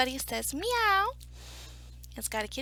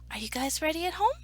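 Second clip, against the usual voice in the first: ordinary delivery, nothing marked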